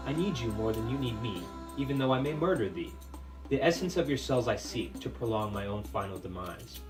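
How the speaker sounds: ordinary delivery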